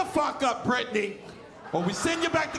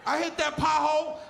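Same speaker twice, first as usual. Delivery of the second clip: ordinary talk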